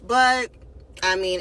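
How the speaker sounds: ordinary talk, nothing marked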